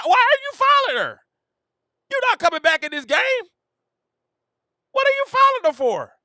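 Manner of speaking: high, incredulous shout; exasperated yell. Real, not acted. Streamer yelling at Tina Charles for fouling, high and exasperated. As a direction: demand it like she's lost her mind.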